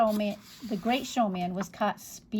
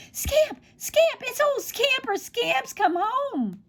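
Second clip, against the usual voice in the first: put-on very high, excited boy's shouts, slowing at the end; boy greeting his lost dog; joyful shouting; 14 semitones higher, slower than usual. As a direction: shout with pure joy.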